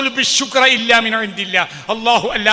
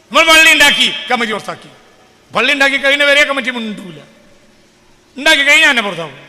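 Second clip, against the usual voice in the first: loud, raised voice, pressing fast; rally the listeners with rising force; impassioned preaching; 6 semitones higher, 7 dB louder, faster than usual. Acted. (ആവേശത്തോടെ ഉറക്കെ പ്രസംഗം)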